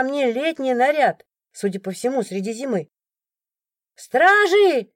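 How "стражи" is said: a high, loud cry for the guards; shouted alarm. A narrator's reading. Someone in the crowd calling for the guards, loud high shout. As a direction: yell it out across the crowd. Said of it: "вдруг кто-то выкрикнул".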